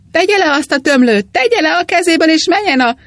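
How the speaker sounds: gardener yelling, high and loud; shouting. A narrator's reading. Angry gardener shouts at the priest. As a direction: bellow at the man with the hose. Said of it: "kiabálta".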